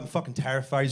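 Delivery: ordinary talk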